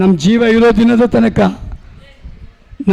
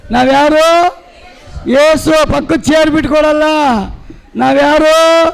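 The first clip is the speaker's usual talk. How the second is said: rousing preaching; 3 semitones higher than usual. Acted. Preacher rousing the congregation with call-and-response, building higher. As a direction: whip up the congregation, question after question.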